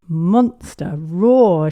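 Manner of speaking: loud, drawn-out play roar; shouted monster roar. A narrator's reading. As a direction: roar it slowly like a little monster. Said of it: "shouted George".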